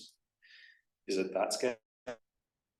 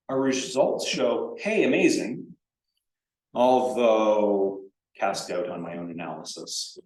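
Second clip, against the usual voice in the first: slower and louder, a hammed-up hey amazing; mock excited grant writer; 6 dB louder, slower than usual. A lecturer mimics a grant writer, mock enthusiastic. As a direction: play the overeager applicant who buries the good news. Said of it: "If you're writing a grant and you say".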